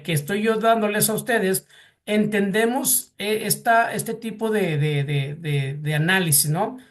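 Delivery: normal talking voice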